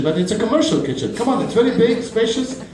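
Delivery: ordinary talk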